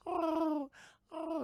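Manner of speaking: high, whimpering oh; mock crying. Monster mother sobbing over dead eggs. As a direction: sob it out like a heartbroken monster. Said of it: "she's crying and shit".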